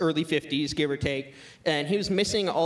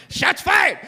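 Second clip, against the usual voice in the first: loud, high laughing call; loud laughing "Shots fired!"; excited shout; 8 dB louder than usual. Real, not acted. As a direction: crow it out over the laughter. Reacting to burn, laughing.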